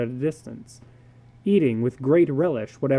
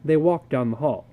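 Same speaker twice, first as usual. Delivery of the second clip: plain reading voice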